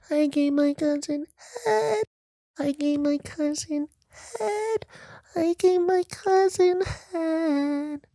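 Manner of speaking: very high sing-song, slow. Retold or imitated. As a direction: squeal each head way up. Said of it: "That's how he said it".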